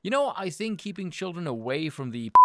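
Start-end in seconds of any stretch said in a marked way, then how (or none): none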